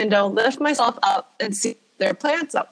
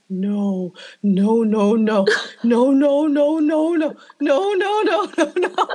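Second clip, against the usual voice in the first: repeated refusal climbing steadily higher; hammed-up mounting alarm; building panic; 4 semitones higher, slower than usual. Acted panicked refusal, turning to flee.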